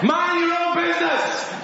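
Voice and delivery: high, called-out retort without real force; mock indignant shout. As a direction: shout it back across the water, playfully. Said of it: "they wanted to shout more".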